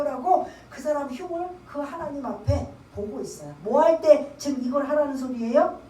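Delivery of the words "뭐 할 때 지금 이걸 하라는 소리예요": normal talking voice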